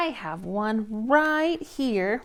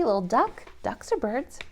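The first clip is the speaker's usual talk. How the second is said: ordinary delivery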